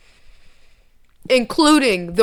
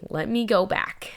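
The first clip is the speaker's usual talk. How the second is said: murmured self-reminder under breath; soft whispered aside; 6 dB quieter than usual. Real, not acted. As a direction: whisper to yourself.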